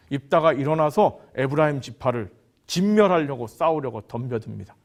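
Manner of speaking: usual speaking voice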